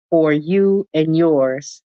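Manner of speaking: ordinary delivery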